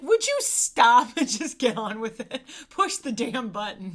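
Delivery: exasperated order. Retold or imitated. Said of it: "he's like".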